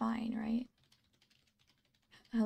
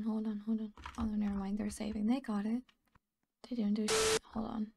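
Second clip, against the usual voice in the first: ordinary talk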